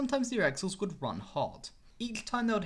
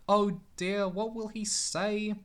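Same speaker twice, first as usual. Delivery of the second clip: sad; 4 semitones higher, 3 dB louder, slower than usual. A narrator's reading. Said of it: "he asked himself sadly".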